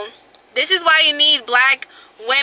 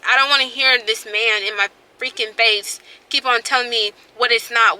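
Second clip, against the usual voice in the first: ordinary delivery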